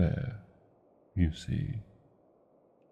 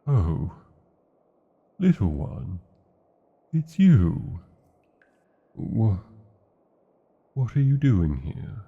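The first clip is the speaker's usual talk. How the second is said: louder, startled dragon; surprised recognition; 6 dB louder than usual. Acted. Dragon realizing the intruder is its human companion, loud surprise. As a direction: catch yourself, startled to see them.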